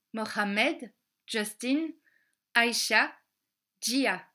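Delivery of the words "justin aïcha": plain reading voice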